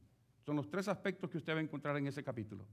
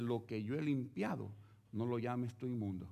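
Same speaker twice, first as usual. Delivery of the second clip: solemn God; 5 semitones lower, 6 dB quieter than usual. Acted. God answers Pedro.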